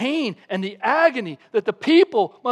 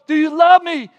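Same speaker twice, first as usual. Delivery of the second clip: higher, louder repeated question; Jesus asking again; 7 semitones higher, 9 dB louder than usual. Retold or imitated. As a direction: press the question.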